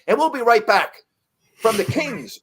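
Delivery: high, loud booming announcer voice; broadcast sign-off. Retold or imitated. Announcer sending the game to commercial, booming and high. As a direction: sell it like a radio play-by-play man going to break.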